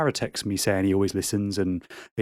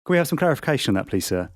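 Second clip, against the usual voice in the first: ordinary delivery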